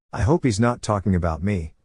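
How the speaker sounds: ordinary delivery, nothing marked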